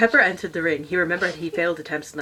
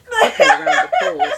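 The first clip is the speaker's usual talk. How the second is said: high, loud laugh through the words; 9 dB louder than usual. Real, not acted. Reader laughing at her own slip, voice jumping high. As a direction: crack up mid-sentence.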